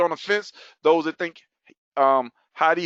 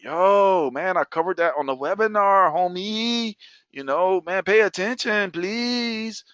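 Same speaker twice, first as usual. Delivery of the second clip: put-on higher, drawn-out, pleading street accent; exaggerated, exasperated homeboy accent; 4 semitones higher, slower than usual. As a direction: plead in a playful homeboy accent, stretching the phrase ends. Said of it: "Y'all like that accent?".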